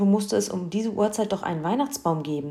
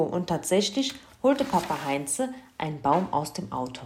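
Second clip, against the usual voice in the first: ordinary delivery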